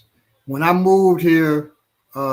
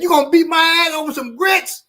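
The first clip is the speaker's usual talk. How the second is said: high, disbelieving mock retort; incredulous comeback; 13 semitones higher than usual. Grits guy answering the threat, high incredulous voice. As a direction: toss it back in disbelief. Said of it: "he said".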